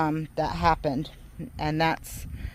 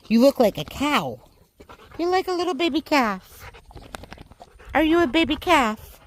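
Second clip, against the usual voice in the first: higher, sing-song voice cooing at a pet; 8 semitones higher than usual. Real, not acted. Baby talk to dog.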